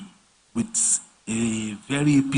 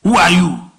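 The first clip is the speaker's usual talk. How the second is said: outraged client; 4 semitones higher, 10 dB louder, slower than usual. Retold or imitated.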